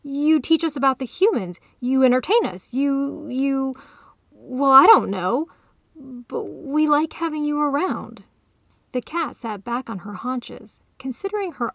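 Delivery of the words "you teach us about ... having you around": higher, louder, faltering plea; worked-up, raised-voice persuading; wild insistence. A narrator's reading. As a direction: insist urgently, groping for reasons. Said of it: "he insisted wildly".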